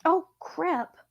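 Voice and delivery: sudden high, soft startled exclamation; startled oh crap. Real, not acted. The cat interrupts her reading, a high startled blurt. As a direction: caught off guard, blurt it quietly.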